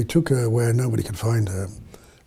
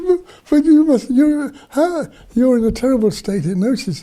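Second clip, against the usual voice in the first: high, loud, stammering mock disbelief; retold astonished insistence; 12 semitones higher, 5 dB louder than usual. Rescuers to the girl who won't leave, retold astonished insistence. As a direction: splutter in mock alarm, pitched way up.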